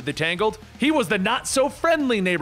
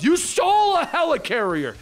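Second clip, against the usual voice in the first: high-pitched accusing yell; indignant, pitched-up outburst; acted yell; 9 semitones higher than usual. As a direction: accuse him in outraged disbelief. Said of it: "Peter yells".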